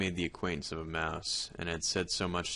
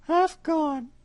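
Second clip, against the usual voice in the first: high cat voice, louder, offhand; sly cat; 10 dB louder than usual. Acted. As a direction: drop the name casually. Cat answers.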